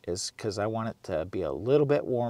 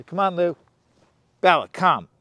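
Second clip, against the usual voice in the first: calling out; 7 semitones higher, 8 dB louder than usual. Real, not acted. Calling dogs outside.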